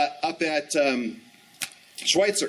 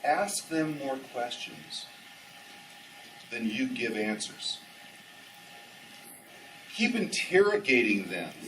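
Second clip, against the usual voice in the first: retold secret reveal; 5 semitones lower, 7 dB quieter, slower than usual. Friend slowly reveals the secret.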